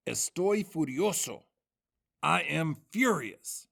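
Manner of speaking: mock furious. Teacher acting out a furious example answer for the class, mock angry. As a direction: act out being furious, a bit over the top.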